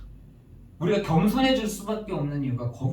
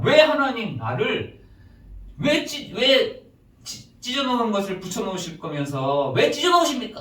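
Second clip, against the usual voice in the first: high, loud protest to God; pleading complaint; 7 semitones higher, 4 dB louder than usual. Acted. Solomon asks God why He tears apart, pleading complaint. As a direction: plead upward in aggrieved questions. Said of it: "막 이렇게 하소연합니다".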